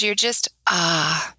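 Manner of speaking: slow contented "ah" trailing off; relaxed sigh. Retold or imitated. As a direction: let it melt into a relaxed sigh. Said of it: "It was this relaxed".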